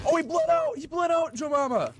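very high, loud shriek of alarm; distressed yelling. Real, not acted. Player telling teammate he bled out, distressed yelling. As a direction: cry out to your teammate in alarm.

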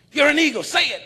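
high, loud insistence; loud urging. Retold or imitated. The man loudly pressing the bird. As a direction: drive it home.

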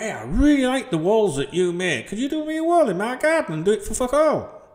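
high, cheeky imagined customer; mock customer. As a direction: play a freeloader asking for a free wall.

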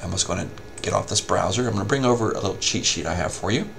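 normal talking voice

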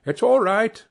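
high, loud, happy call to come ashore; cheerful call. A narrator's reading. Harlequin waving the steamer in, cheerful call. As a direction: beckon them in gladly. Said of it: "as cheerful as you please".

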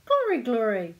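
loud jubilant exclamation; triumphant cry. A narrator's reading. Pirate mother smelling the sea, crying triumphantly. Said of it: "cried his mother triumphantly".

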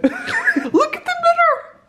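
high giggly squeal; laughing. Real, not acted. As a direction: laugh it out with delight. Laughing, showing the room.

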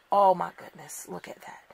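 breathless whisper of awe; awed whisper. Real, not acted. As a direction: whisper it as if you can't believe it. Awed unboxing reveal, whispered.

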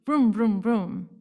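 loud vroom vroom toy car; car noise. A narrator's reading. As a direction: make toy car engine sounds. Kids push toy cars.

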